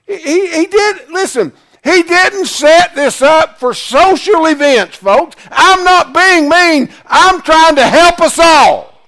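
high, forceful, emphatic sermon delivery; impassioned preaching. Real, not acted. Preacher pleading with the congregation he's trying to help, impassioned.

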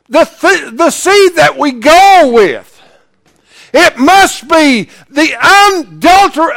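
high, shouted pulpit declaration; stretched-out, thundering sermon climax; full-force preaching. Real, not acted. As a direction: drive each phrase home, loud and high.

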